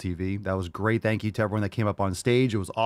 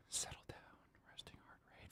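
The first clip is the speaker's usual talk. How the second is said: barely audible, settling murmur; very quiet aside; 31 dB quieter than usual. Real, not acted. Host calming his own heart rate, very quiet. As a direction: talk yourself down almost under your breath.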